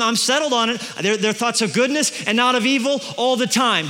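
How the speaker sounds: ordinary talk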